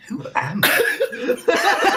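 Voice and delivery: high, slow, laughing question. Real, not acted. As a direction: let the laugh carry the words.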